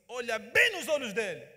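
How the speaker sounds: loud, ringing commanding voice; inner divine voice. Retold or imitated. The voice from God telling the pastor to look, loud command. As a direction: command it like a voice from above. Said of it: "uma voz veio em mim e falou assim".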